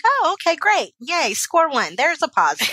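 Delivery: high-pitched mock celebration, easing down; retold happy relief. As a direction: act out a little cheer at good news. Acting her reaction to good news.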